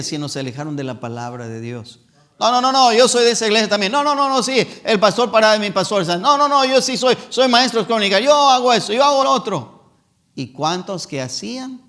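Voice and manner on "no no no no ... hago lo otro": loud, higher, indignant protest; boastful member. Retold or imitated. Preacher mocking a member who brags about his church duties, loud and high. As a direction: protest with puffed-up pride.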